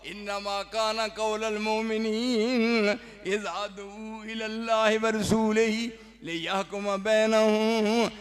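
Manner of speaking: loud, measured chanted recitation; Quranic recitation. Acted. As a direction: intone each word slowly.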